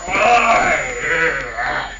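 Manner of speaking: loud, drawn-out mock groan; staggering cry. Rasputin staggering out the door, loud groaning cry. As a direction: groan out loud while lurching along.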